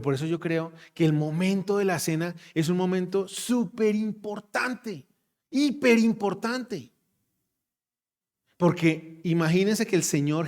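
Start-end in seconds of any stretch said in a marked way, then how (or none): 3.9-6.9 importante and hiper importante stretched slow and high; drawn-out emphasis. Real, not acted. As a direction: lean hard on hiper importante, very slowly. Preacher stresses key word. (énfasis lento en hiper importante)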